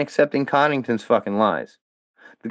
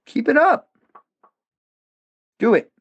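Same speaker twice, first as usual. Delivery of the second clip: taunt; 11 semitones higher than usual. Real, not acted. Taunting his critics.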